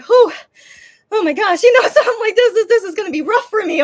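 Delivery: high, loud, breaking into a laugh; overwhelmed mock dismay. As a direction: blurt it out, laughing at your own distress. Retold overwhelmed relief, half laughing.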